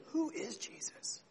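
high, breathy whisper; preacher hushing the crowd, whispered; whispered question. Acted. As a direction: lean in and whisper it. Poses the key question, hushed.

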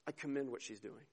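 low, soft, measured defence of her; quiet approval. Acted. Jesus defending Mary to the disciples, gentle quiet approval. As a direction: speak softly and let it settle. Jesus answering the complainers, acted.

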